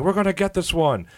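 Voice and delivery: high, mock-boastful fan voice; a high impression of a cocky Leafs fan; mock confident fan. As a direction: ham up a cocky rival fan. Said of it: "he kept saying like".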